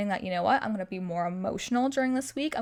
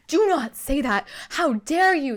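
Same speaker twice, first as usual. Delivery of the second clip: loud, higher-pitched mock indignation; offended; 4 semitones higher, 6 dB louder, slower than usual. Telling off people who call her unlucky, offended. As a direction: scold them as if deeply affronted. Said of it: "I get so offended".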